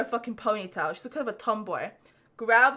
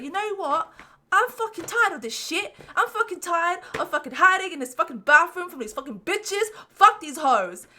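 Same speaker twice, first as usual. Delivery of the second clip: higher, fed-up rant building louder; retold furious outburst; 6 semitones higher, 3 dB louder than usual. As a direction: blow up at last, done hiding. Retold outburst leaving the bathroom.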